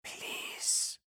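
breathy alien whisper; dry whisper. Acted. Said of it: "in the dry whisper of the Tau voice".

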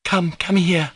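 high-pitched, commanding summons; urgent, imperious call; imperious cry. Acted. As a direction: order them over like a little madam. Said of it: "she cried imperiously".

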